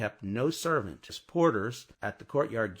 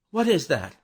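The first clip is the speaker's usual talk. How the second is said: louder, alarmed question; startled cry; 6 semitones higher, 8 dB louder than usual. A narrator's reading. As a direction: jump at a sudden sound. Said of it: "she cried".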